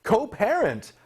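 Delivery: high-pitched disbelief; incredulous echo. Real, not acted. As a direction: repeat the word in sheer disbelief. Incredulous echo of clip's word.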